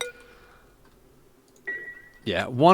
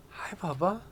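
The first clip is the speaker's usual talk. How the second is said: cooing to cat; 17 dB quieter, slower than usual. Real, not acted. Streamer coos to the cat on his shoulder, soft and tender. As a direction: murmur tenderly to the cat right by your ear.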